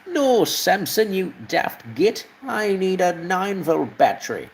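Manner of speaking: high, slow, exasperated toy engine voice; horrible engine impression. Engine scolding Samson for the wrong battery, high cartoon voice. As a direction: scold like a cranky little train, up high. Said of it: "that was a horrible impression".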